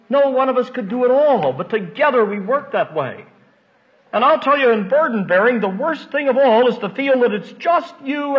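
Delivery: high-pitched, earnest pulpit urging; impassioned preaching. Real, not acted. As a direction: press it on the congregation with fervor.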